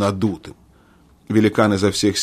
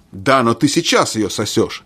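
plain reading voice

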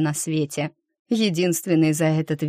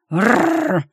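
loud growling roar; angry roar; 5 semitones higher, 5 dB louder than usual. Acted. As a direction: let out a beastly growl. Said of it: "взревел вскочивший на ноги эльф".

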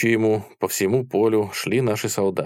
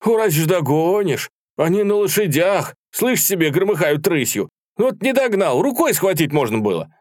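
high, animated battle tale; excited boasting; 9 semitones higher, 4 dB louder than usual. A narrator's reading. Soldier telling comrades of chasing riders, excited. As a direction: tell it at a high, eager pitch.

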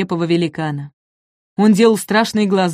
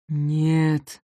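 low, soft weary no; sighing no; 4 semitones lower, 5 dB quieter than usual. Acted. Апатозавр вздыхает, отказывая: устало. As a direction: sigh out the refusal. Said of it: "вздохнул Апатозавр".

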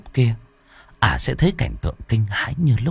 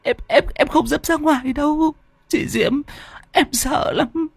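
much higher, pleading terrified voice; trembling fear. A narrator's reading. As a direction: beg to stay, shaking with fear. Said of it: "giọng ả run rẩy".

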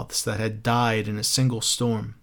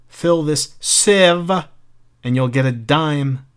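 slow, louder, higher taunt; taunting cousin; 4 semitones higher, 5 dB louder, slower than usual. Acted. Cruel cousin taunting the boy at the beach. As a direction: tease the child cruelly, slowly.

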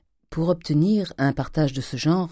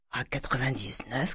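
soft, rising question; hushed questioning; 8 dB quieter than usual. A narrator's reading. Grandet checking the bond price with Cruchot, hushed question. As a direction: repeat the figure softly, testing it.

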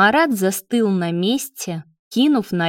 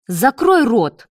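higher-pitched angry bark; barked order; 4 semitones higher, 4 dB louder than usual. A narrator's reading. David silences his lover, barking. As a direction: snap it to silence him. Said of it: "рявкнул на него Давид".

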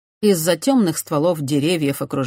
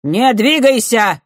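knight's command; 7 semitones higher, 9 dB louder, slower than usual. A narrator's reading.

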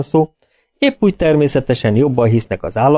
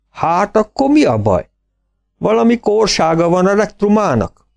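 farmer voice; 10 semitones higher than usual. A narrator's reading.

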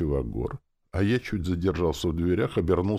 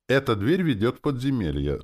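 higher-pitched, eager proclamation; enthusiastic announcement; 8 semitones higher, 4 dB louder than usual. A narrator's reading. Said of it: "с воодушевлением возвестил Валера". (с воодушевлением, на подъёме)

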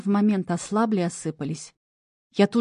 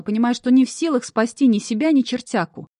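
plain reading voice

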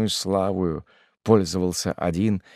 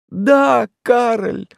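high, raised plaintive lament; anguished widow; 8 dB louder than usual. A narrator's reading. Widow pleads her innocence to Karl, high anguish. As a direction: plead your innocence to your love. Mother voiced by narrator.

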